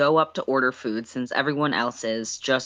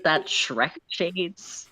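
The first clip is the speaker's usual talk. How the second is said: slow correction; 4 semitones higher, much slower than usual. Real, not acted. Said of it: "I almost said charades".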